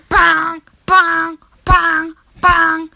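high, loud repeated alarm blare; imitated phone alarm going off; iPhone alarm. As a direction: honk each one on the same high note. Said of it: "so it's like".

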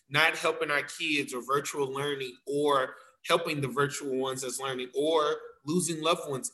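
ordinary talk, nothing marked

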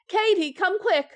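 high, loud urgent call. Acted. Wife calls the maid as husband faints, loud and high.

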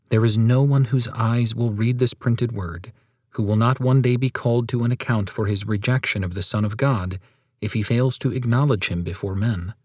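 ordinary talk

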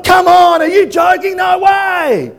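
high, loud disbelieving yell; incredulous shout. Acted. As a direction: shout it like he can't believe it. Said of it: "Man's like".

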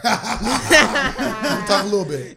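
slow teasing through a laugh. Real, not acted.

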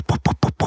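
breathy unvoiced puffs; scared puffing. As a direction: puff out nervous breaths. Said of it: "bei Stefanie geht sofort puh".

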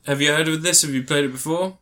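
usual speaking voice

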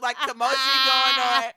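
ordinary delivery

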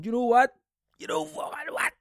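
high, sudden burst of inspiration; mock inspired outburst. Imagining himself deciding to record, excited. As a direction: jump up in pitch like an idea just struck.

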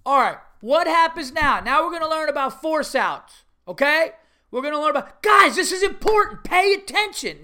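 loud, high drill-giving coach; hollering coach. Retold or imitated. Coach teaching force outs to kids, hollering for attention. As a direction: lecture loudly, building to a sharp shout. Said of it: "so the guy's going".

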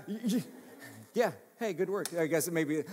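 ordinary talk, nothing marked

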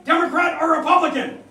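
high, loud challenge shouted at a caller; acted yell. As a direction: imitate a hostile householder yelling a demand. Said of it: "he yells at me".

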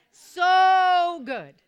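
"So" stretched with a lot of O's, shouted high; long drawn-out so. Shown as a demonstration. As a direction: hold the "So" in "So good" a long time, loud and high. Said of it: "S with a lot of O's".

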